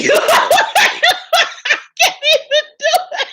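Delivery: high, loud laughing fit swallowing the words; helpless laughter. Real, not acted. Host failing the impression, helplessly laughing. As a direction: break up completely, words drawn out in laughter. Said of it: "let me stop laughing".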